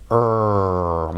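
long er with the tongue tip curled up; American r sound. Shown as a demonstration. Teacher demonstrating the English er sound. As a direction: hold an American er. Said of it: "en inglés la punta de la lengua va hacia arriba".

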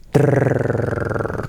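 suspenseful tongue-trilled drumroll; redoble de lengua. Shown as a demonstration. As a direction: trill a drumroll before the reveal. Tongue drumroll before revealing pronunciation. (redoble con la lengua)

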